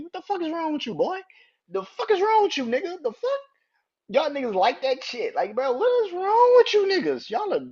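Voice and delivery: high, loud exasperated yell; high outraged rant. Real, not acted. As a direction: shout at them in disbelief. Ranting about the screaming.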